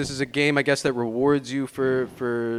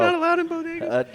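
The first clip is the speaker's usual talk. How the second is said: high voice. Acted. High-voiced joke line.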